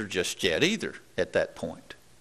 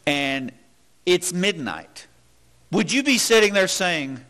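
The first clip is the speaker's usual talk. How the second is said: ordinary talk, nothing marked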